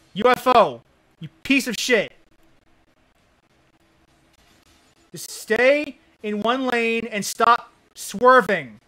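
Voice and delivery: yelling in frustration; angry shout. Real, not acted. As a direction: shout it at the screen. Raging at swerving car.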